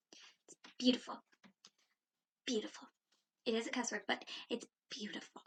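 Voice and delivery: soft hushed whisper; whispering, savouring the word; whispered admiration. Real, not acted. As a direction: breathe it out in awe. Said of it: "admiring the word".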